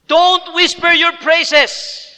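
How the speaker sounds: loud, slow, high preaching; full-voiced slow exhortation; forceful plea. Real, not acted. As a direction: drive the point home to the congregation. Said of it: "preaching at full force".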